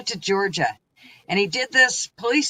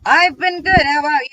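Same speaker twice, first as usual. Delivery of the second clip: excited; 10 semitones higher, 10 dB louder than usual. Real, not acted. Cheerful greeting to a friend.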